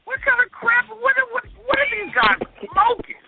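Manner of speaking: rising, baffled disbelief; who on earth, cranked way up; incredulous. Real, not acted. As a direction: pitch climbing in outraged disbelief. Host baffled by listener name.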